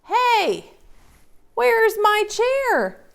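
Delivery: very high, loud little bear; squeaky baby bear; tiny bear piping up. Acted. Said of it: "asked Baby Bear".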